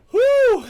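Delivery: breathless high whoop after laughing; loud whew. Real, not acted. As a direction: blow out a big relieved whew.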